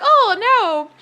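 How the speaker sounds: "Oh, no" through a laugh; laughing dismay. Real, not acted. Caught between two coworkers, laughing in dismay. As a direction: laugh it out helplessly.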